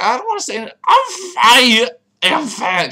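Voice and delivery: loud, high, very slow exclamation; drawn-out mock cry. Player voicing a game exclamation, hammed-up slow cry.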